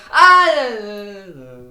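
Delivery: high loud strained yelps; mock singing yelps. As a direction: squawk it out of tune. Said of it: "he's going like".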